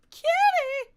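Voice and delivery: high, loud delighted shout; excited squeal. Real, not acted. Streamer spotting horses, shrieking with delight. Spots cat in game.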